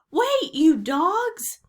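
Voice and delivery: high, loud, drawn-out yell; desperate shout. A narrator's reading. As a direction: holler slowly after the departing crew. Captain calling after departing boat.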